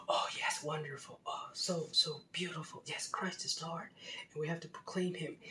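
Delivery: breathy, hushed, awestruck churchgoer; soft reverent gushing, mocking the faithful; mock pious whisper. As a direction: whisper it like a swooning believer. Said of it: "his heart would just go".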